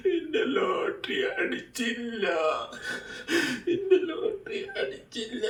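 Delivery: crying. Acted. മോൻ കരയരുത്.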